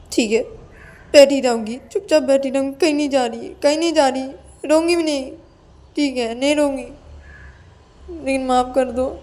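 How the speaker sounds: high, whiny promises to behave; pleading Ananya, tearful. Acted. Scolded Ananya promises Payal she will stay put, tearfully pleading.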